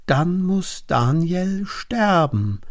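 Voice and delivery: high, drawn-out cry of verdict; calling out. A narrator's reading. Princes demand Daniel's death from the king, calling out. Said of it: "riefen die Fürsten".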